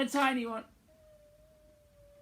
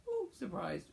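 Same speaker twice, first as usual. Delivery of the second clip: mock surprised; 2 semitones higher, 11 dB quieter than usual. Shown as a demonstration. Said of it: "You have to go".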